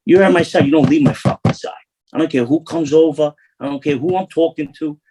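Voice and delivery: retold boss tearing into him; scolding boss. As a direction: lay down the law like an angry capo.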